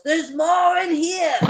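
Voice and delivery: high, drawn-out alarm shout; guard hollering for backup; yelling. Acted. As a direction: shout it out slowly to bring others running. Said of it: "and yells".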